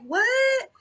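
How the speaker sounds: very high startled what; shocked what. Retold or imitated. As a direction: toss out a high, stunned what. Retold shocked reaction.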